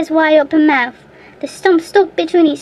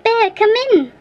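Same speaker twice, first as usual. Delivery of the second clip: higher, louder call for help; 7 semitones higher, 4 dB louder than usual. A narrator's reading. Boy calling the bear into the cave, high shout. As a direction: summon the bear with a sharp call. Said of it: "and so he called".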